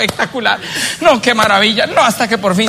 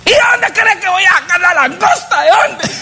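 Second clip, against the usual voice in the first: much higher, slow, in a put-on wife voice; shrill wife protest; 10 semitones higher, slower than usual. Wife protests to husband where lobster comes from, high. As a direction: voice the wife, outraged and disbelieving.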